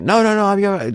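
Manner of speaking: high-pitched mock refusal, no added volume; retold yelling. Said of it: "they're yelling back and forth".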